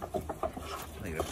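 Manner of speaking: slow, breathy whisper while watching the puppies; slow hushed whisper. Real, not acted. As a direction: whisper it so the dogs aren't disturbed.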